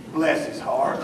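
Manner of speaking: low, soft Bless his heart; a low soft mock-pious blessing. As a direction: drop your voice to a pious hush. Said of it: "if you put that at the end".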